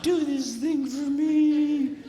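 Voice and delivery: drawn-out mock wifely coaxing; imitated pleading, slow and syrupy; wheedling. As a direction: stretch it out like a wife sweet-talking her husband. Acting out his wife wheedling him.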